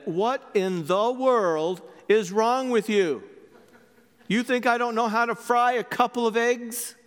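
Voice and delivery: indignant wife. Acted. Wife turning on her husband over the eggs, mildly indignant. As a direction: push back, insulted.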